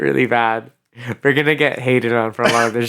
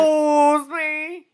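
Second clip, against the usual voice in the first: very high, drawn-out squeal; mock-offended; 13 semitones higher, much slower than usual. Cohost interrupting a claim about Southern accents, mock-offended.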